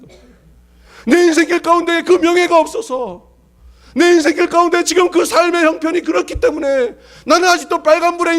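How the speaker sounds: 높은 목소리로 힘차게 외치는 설교; 목청 높여 회중에게 호소하듯; 열정적인 설교. Acted. Preaching at full force. (힘찬 설교조)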